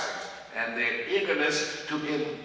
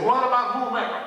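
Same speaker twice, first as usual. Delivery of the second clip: louder, higher-pitched question; eager student challenge; 8 semitones higher, 5 dB louder, faster than usual. Retold or imitated. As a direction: jump in with the question.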